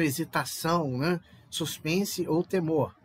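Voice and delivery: ordinary delivery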